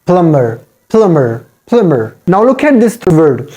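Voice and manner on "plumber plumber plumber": "plumber" with a silent b; "plumber" with just m in the middle; corrected plumber. Shown as a demonstration. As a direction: say "plumber" as plum-mer. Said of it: "Just make it silent here".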